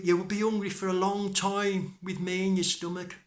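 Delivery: high, loud, pleading little-hero voice; bargaining Tom. Acted. Tom tempts the wolf with food, high and loud. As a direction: talk fast-thinking Tom out of trouble, high and insistent.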